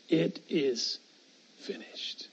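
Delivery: a breathy whisper fading out. Acted. Preacher echoing Jesus's dying words to the congregation, whispered.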